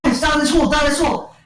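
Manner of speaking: high-pitched urgent call; mock urgency. Characters flee the bikers, mock urgent cry. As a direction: call it high, hurrying them. Acts out urgent calling to group.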